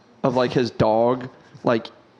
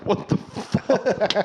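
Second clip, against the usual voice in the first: drawn-out, higher shocked voice; slow disbelieving what the fuck; 8 semitones higher, much slower than usual. Retold or imitated. Imitating a customer offered phlegm juice, slow shocked disbelief. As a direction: drag it out in mock horror. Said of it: "And you're like".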